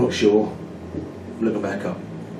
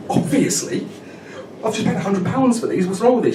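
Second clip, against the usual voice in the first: indignant; 6 semitones higher than usual. Retold or imitated. Retold row with girlfriend.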